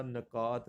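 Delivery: نکات as "na-kaat", short a first; wrong pronunciation. Shown as a demonstration. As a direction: say نکات with "na" at the start. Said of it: "نکات نہیں ہوتا".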